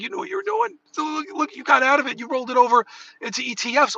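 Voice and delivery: a wife teasing her husband; imitating wife. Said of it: "she's like".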